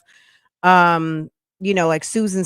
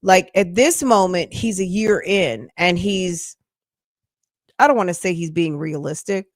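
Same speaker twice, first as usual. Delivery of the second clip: normal talking voice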